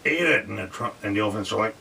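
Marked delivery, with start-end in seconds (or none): 0.0-0.5 mock yell. [name] yelling at elephants.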